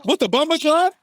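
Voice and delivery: high-pitched mock Jamaican-Boston curse; Jamaican-Boston accent. Host mimicking his drunk friend, high Jamaican-Boston accent. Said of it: "Jamaican and he Boston".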